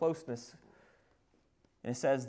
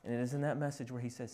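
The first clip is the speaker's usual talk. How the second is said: soft preaching; 4 dB quieter than usual. Acted. Preacher speaking of intimacy with God, soft preaching. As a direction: draw them in close and hushed.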